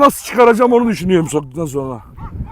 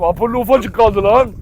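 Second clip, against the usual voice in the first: normal talking voice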